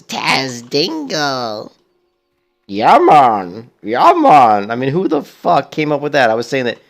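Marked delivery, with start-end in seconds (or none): 0.0-1.7 slow drawn-out Jamaican accent mocking the game; mocking Jamaican troll voice. Streamer mocks the troll voices in a Jamaican accent. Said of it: "those god-awful, stupid, frickin' Jamaican voices". 2.7-4.7 loud laid-back Jamaican "Yeah, man"; mock the Jamaican troll lilt, louder; mock Jamaican accent. Streamer parodying Blizzard's troll voices in a mock Jamaican accent. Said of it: "those god-awful, stupid, frickin' Jamaican voices".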